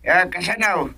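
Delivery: soft, thin voice down a phone line. Acted. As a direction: sound like the caller on the other end. Other end of a phone call.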